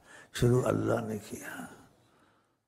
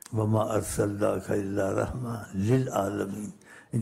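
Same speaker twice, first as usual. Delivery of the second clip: Quranic recitation; 4 semitones lower than usual. Acted. Quran verse quoted in sermon.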